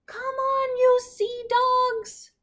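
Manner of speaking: high, slow, squeaky captain's yell; tiny pirate captain yelling. Acted. Little captain shouting orders at his crew in a high squeak. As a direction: yell it like a tiny pirate no one can hear. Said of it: "yelled at them".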